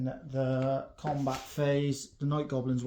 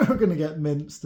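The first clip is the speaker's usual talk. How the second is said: laughing through the words, a bit louder; amused, can't help smiling; 3 semitones higher, 6 dB louder than usual. Real, not acted. Player admitting to his opponent he feared for his Orcs, amused. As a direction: let a laugh break into the line. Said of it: "I couldn't help but smile".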